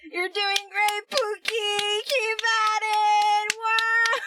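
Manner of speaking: hammed-up supportive wife cheer; mock supportive cheer. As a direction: cheer him on, half joking.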